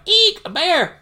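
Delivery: high, loud cartoon squeal; mock frightened shriek. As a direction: shriek in hammed-up panic at a bear. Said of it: "Donald's so scared".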